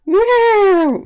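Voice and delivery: loud high meow; loud cat yowl. Retold or imitated. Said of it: "Really, really, really, really loud".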